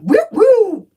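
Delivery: high-pitched "woo woo" siren whoop; loud mimicked siren blips; imitated police siren. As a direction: whoop like a cop car pulling up. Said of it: "Two cops pull up".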